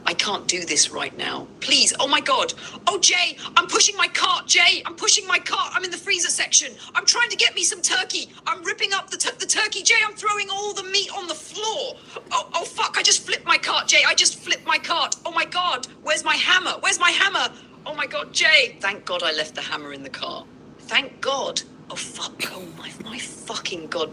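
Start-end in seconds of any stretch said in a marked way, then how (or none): 1.6-21.6 high, panicked shrieking rant; a meltdown shouted from the store aisle; frantic panic. Pretend. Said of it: "and that fast you lost it?". 21.9-24.1 panicked whisper. Pretend. Whispered panic mid rampage.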